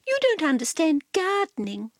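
prissy cat explaining; Cat's voice. A narrator's reading. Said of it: "announced Cat".

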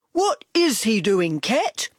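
big loud whiny dog voice; Dog's moaning voice. A narrator's reading. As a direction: moan it out as the grumpy dog. Dog asks Cat.

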